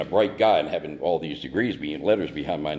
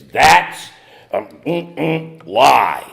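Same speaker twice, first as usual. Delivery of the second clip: much louder, higher drawn-out scream; 8 semitones higher, 10 dB louder, much slower than usual. Retold or imitated. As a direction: yell it across the table at him. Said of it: "Just screamed it at me".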